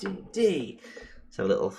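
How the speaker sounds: talking through a big yawn. Real, not acted. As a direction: yawn the words out slowly. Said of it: "How dare you yawn?".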